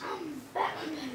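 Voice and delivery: slow, soft hero impression; quiet, drawn-out masked-hero voice; Batman. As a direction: draw it out in a hushed affected voice. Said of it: "He's gonna affect his voice".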